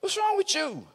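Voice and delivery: a lightly voiced scoffing wife; mocking Jezebel. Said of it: "She said".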